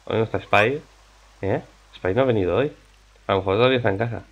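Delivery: normal talking voice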